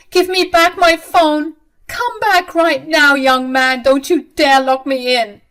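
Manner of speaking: shouted high and loud after someone leaving; angry shout. Acted. Mother shouts after son who took her phone, angry yelling. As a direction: yell him back, furious.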